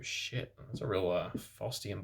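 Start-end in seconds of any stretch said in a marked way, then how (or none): none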